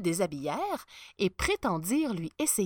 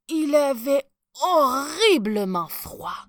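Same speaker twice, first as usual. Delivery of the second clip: "horriblement" drawn out, loud and high; stress on HORRIBLEMENT; 6 semitones higher, 9 dB louder, slower than usual. A narrator's reading. Narrator revealing the emperor is freezing, "horriblement" dragged out emphatically. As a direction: lean long and hard on "horriblement".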